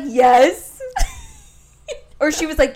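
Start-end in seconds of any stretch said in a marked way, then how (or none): 0.0-0.8 loud yes breaking into laughter; laughing yes. Retold or imitated. Answering the 911 lady about danger, laughing yes. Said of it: "I was like". 0.8-1.3 loud, high cackle; high squealing laugh. Real, not acted. Retelling the 911 call to a friend, squealing laugh.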